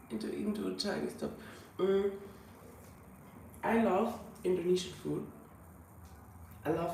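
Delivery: ordinary talk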